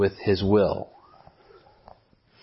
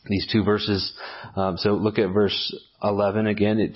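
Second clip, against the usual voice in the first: ordinary talk, nothing marked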